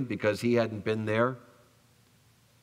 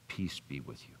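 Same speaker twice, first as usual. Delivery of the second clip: much lower and much softer blessing; gentle Jesus; 10 semitones lower, 12 dB quieter than usual. Retold or imitated. Jesus greeting the frightened disciples, low and hushed. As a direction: breathe the blessing low and soft.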